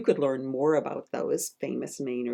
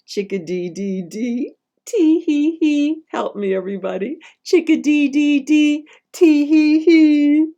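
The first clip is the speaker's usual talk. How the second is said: giggling chickadee; 10 semitones higher, 8 dB louder than usual. Acted. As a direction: giggle like a hiding little bird.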